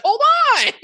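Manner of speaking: squeaky high mock voice, laughing; playful impression of a busy colleague; imitating her. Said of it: "She's like".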